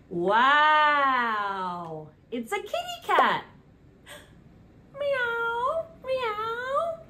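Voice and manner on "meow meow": high squeaky kitten meows; playful high cat call for a toddler. Shown as a demonstration. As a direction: meow like a little cat, high up. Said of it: "That's the sound a cat makes".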